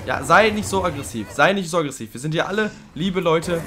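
loud, pleading reprimand; exasperated scolding. Real, not acted. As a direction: tell off an agitated pet. Scolding the agitated creature.

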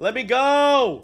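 high, loud, drawn-out shout; protesting yell. Real, not acted. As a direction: yell to be put down. Picked up by another player.

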